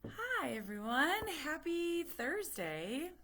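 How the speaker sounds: high, drawn-out cheery hello; bright slow singsong welcome; sing-song hello. Real, not acted. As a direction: greet everyone brightly, stretching the words. Sing-song greeting to camera.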